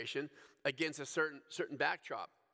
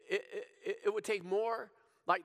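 normal talking voice

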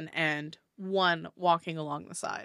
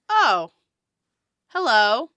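a bit higher, louder greeting; surprised dwarf; 4 semitones higher, 9 dB louder, slower than usual. Acted. Dwarf first notices Shar, lifted hello. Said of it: "he's like".

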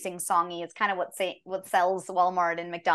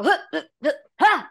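short loud exertion grunts; effort grunts; 9 semitones higher, 7 dB louder than usual. Shown as a demonstration. Actor showing booth work for trips and jumps, effort grunts. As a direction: demo of jumping and tripping efforts. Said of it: "So we get to go".

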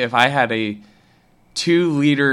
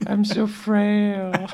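high, slow feeble whine; frail whimper; 10 semitones higher, much slower than usual. Pretend. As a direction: sound weak and pitiful. Plays along with the sleepy act.

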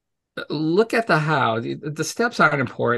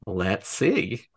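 mock pondering; 5 semitones lower, 4 dB quieter than usual. Retold dry answer to silly question.

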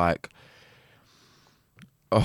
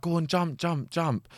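high-pitched mock chant of the boys; boys egging her on; 9 semitones higher, slower than usual. Boys in the water urging her to jump, high mock chant. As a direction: egg her on like the lads in the lagoon. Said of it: "they're like".

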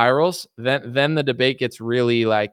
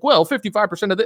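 fast, higher know-it-all statistics voice; Gavin Newsom; 7 semitones higher, faster than usual. Retold or imitated. Mocking the governor quoting statistics, fast and higher. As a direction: rattle off numbers smugly. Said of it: "He's like".

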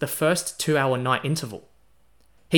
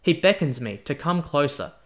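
usual speaking voice

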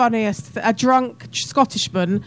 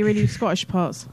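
normal talking voice

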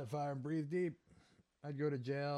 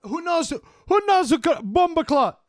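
much higher, much louder mock roadman; Jamaican-style London slang; 15 dB louder than usual. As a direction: yell it like a hyped London roadman. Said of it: "now they speak like Jamaicans".

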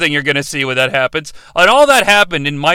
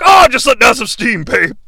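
cool, casual Steiner; 5 semitones higher, 5 dB louder than usual. Retold or imitated. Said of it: "And Steiner's cool. He's like".

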